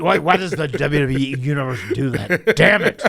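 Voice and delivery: loud, laughing mock boss rant; imitating the boss. Wrestler acts out the boss asking about the chant. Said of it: "Vince said".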